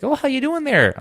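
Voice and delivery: high singsong Minnesota greeting; Minnesota accent. Retold or imitated. Best Buy clerk greeting customer, high Minnesota accent. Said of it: "the lady's like".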